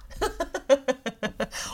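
爽朗的高音笑声; 突然放声笑出来; 高声大笑. Real, not acted. 开玩笑说节目太严肃. (开怀大笑)

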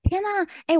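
high shocked gasp; reacting in sudden alarm. Real, not acted. Shocked by self-learning AI.

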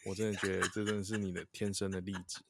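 normal talking voice

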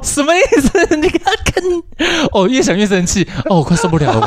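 high-pitched hammed-up outrage; mock indignation. Host playfully fumes on the guest's behalf, hammed-up high outrage.

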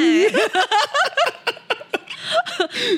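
bright high-pitched giggle. Real, not acted. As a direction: break into a giggly laugh. Giggling after Japanese line. (高声咯咯笑)

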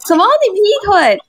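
high, loud shocked cry; shocked disbelief. Retold or imitated. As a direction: gasp out the disbelief. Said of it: "然后A女就：". (惊讶地高声质问)

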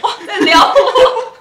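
high, loud, slow dreamy squeal; mock swoon. Laughing at her own crush, a hammed-up swoon. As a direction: ham up the swoon.

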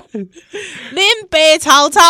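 slow loud swaggering Hokkien boast; Taiwanese Hokkien. Pretend. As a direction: drag out 林北曹操 in Taiwanese. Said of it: "真的是林北".

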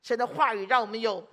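ordinary delivery

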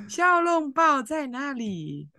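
put-on slow Mandarin with a Japanese accent; drawn-out Japanese tourist accent. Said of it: "给我学那个日本腔". (慢慢的日本腔中文)

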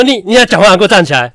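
high, loud furious scolding; angry teacher yell. Retold or imitated. Kindergarten teacher yelling at the boy to stand up, loud and high. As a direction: bark the order at the kid.

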